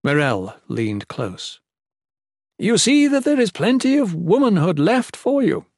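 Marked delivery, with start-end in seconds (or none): 2.6-5.7 high, loud shout over a crowd; yelling. A narrator's reading. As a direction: call out over the noise. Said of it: "she yelled".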